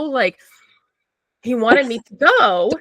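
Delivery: ordinary talk, nothing marked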